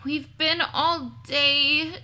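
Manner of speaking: slow, tired drawn-out sigh; weary. Acted. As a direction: drag it out, worn out. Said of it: "she thought".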